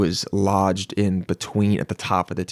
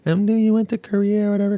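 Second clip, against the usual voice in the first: imitating her; 9 semitones higher than usual. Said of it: "she's like".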